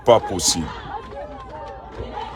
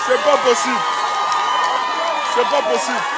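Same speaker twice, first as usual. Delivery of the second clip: shouted disbelief; 12 semitones higher than usual. Real, not acted. Overwhelmed by crowd.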